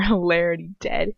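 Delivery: plain reading voice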